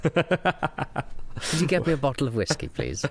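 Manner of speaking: slow, high put-on English voice dropping on "please"; mock-refined English accent. Traveller begging for whiskey, slow mock-refined English accent. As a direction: draw the polite request out in mock-refined English. Continues joke polite traveller voice.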